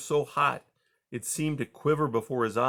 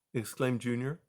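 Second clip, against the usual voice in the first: usual speaking voice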